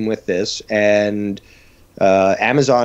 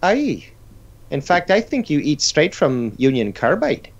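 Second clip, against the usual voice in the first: put-on Irish accent. Irish voice continues.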